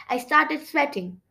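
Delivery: ordinary delivery, nothing marked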